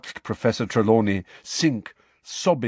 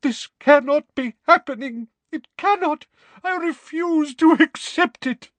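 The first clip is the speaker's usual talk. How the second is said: much higher, trembling voice of a distraught seer; distraught Trelawney; 4 dB louder than usual. Acted. Sacked teacher refusing to accept her dismissal, high and distraught. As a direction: plead in a high, shaky old witch voice.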